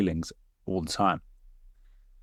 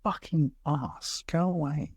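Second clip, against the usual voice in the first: muttered insult; 4 semitones higher, 8 dB quieter, slower than usual. Retold or imitated. Retort to the annoying manager.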